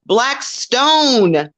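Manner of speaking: ordinary talk, nothing marked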